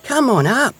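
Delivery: high-pitched, excited little hedgehog. A narrator's reading. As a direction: call friends up in eager, high voice. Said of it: "laughed Little Hedgehog excitedly".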